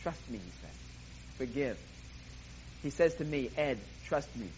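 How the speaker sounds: God quietly reassuring a hurting listener; gentle voice of God. Acted. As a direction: speak God's words low and kindly. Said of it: "all God says to do is".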